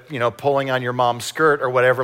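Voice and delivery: usual speaking voice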